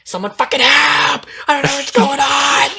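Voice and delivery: high, loud frantic shout; panicked shout. Retold or imitated. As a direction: yell for help like she's in real danger. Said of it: "she's like".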